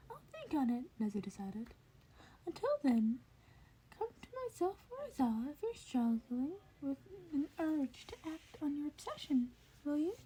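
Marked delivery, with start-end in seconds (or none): 0.1-0.9 lightly raised, whispered curious principal; Nezu's voice. Acted. Nezu voiced by reader. 2.5-10.2 lightly raised, whispered curious principal; Nezu's voice. Acted. Nezu voiced by reader.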